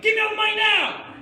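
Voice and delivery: screeched high demand; crazed robber shout. Acted. Fake-gun robber to the counter lady, crazed high shout. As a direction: yell it wildly at full volume. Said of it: "making my eyes kinda get all crazy".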